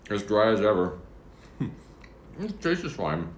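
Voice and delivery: chewing while talking; talking through a mouthful; mouth full. Real, not acted. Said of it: "shouldn't talk with my mouth full".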